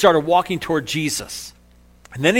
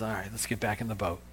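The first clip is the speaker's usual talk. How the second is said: hushed, low reassurance; tender; 6 semitones lower, 12 dB quieter than usual. Acted. Jesus dusting Peter off after his fall, tenderly. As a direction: gently coax him along, very softly. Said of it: "That tender moment between Jesus and Peter".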